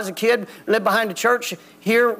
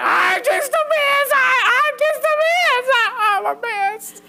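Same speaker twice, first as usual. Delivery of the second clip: much higher, wailing and bawling; bawling drunk kid. Retold or imitated. A drunk kid bawls to the preacher, high-pitched wailing. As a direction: wail it like a drunk who is falling apart. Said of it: "he's just bawling".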